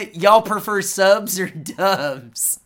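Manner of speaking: slow, drawn-out mock Georgia Southern accent; slow mocking Southern accent, like a Georgia con-goer. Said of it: "you fucking dopey-ass accent".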